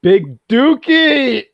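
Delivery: high, loud, drawn-out "Big Dookie!"; exaggerated crowing shout of a silly name; gleeful mock shout. Gleefully blurts out the misheard name.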